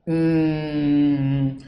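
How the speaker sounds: ordinary talk, nothing marked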